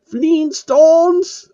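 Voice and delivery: very high loud shrieking cry; monster roaring. A narrator's reading. The monster shrieks its catchphrase, high and loud.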